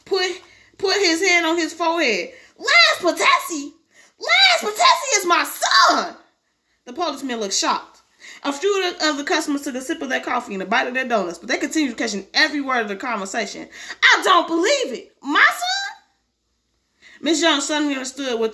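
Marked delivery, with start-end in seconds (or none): 2.6-3.7 high, loud, drawn-out question; stunned disbelief. Acted. Manager stunned by son's name. 4.2-6.2 high, loud, slow outburst; shocked exclamation. Acted. Manager shocked about own son. 14.0-16.0 high, loud, climbing to a squeak; disbelief. Acted. Manager in disbelief.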